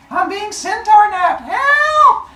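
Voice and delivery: high, loud mock scream for help, a bit slow. Wife kidnapped by the centaur, shrieking for help. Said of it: "She starts screaming".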